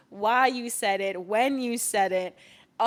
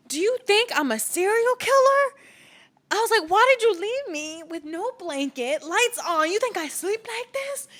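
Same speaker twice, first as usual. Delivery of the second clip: much higher and louder, indignant; indignant squeal; 12 semitones higher, 5 dB louder than usual. Retold or imitated. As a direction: squeal it out in disbelief. Retold indignant quote to Adam.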